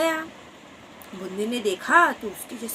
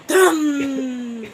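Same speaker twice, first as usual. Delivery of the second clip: thunder boom; 3 semitones higher, 7 dB louder than usual. Acted. Thunder burst sound.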